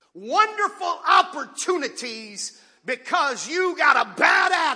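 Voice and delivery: loud, high, slow preacher's cry; full-force preaching. Acted. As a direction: drive the point home from the pulpit.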